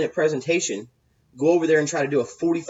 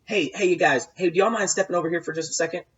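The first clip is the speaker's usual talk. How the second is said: higher, quick friendly call; calling guys over; 6 semitones higher than usual. Shown as a demonstration. Host pulling three prospects away from the crowd, quick friendly call. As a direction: pull them aside with an easy, quick invite. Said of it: "But basically I would say".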